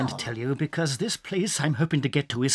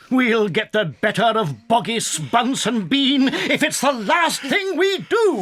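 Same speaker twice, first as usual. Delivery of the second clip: slow, loud, determined vow; defiant shout; 5 semitones higher, 4 dB louder than usual. Acted. Father fox vowing revenge on the farmers to his family. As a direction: shout it out as a promise.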